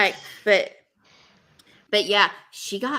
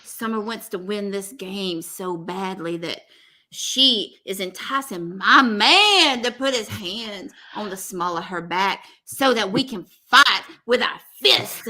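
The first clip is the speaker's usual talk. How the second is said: mock Georgia accent. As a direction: imitate her with a stretched Southern accent. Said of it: "I say it as if she's, like, from Georgia".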